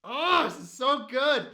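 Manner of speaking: high, drawn-out fan delight; delighted gushing. Real, not acted. Reactor loving a favorite movie scene, delighted gushing. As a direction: gush slowly, savoring every word.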